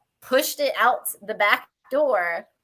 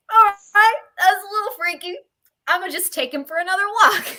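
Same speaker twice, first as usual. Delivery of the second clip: retelling a freaked-out moment, pitched way up and shaky with nerves; spooked nervous reaction; 10 semitones higher, 5 dB louder, faster than usual. Retold or imitated. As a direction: voice jumps much higher and louder, half crying. Retold reaction to dog freaking out.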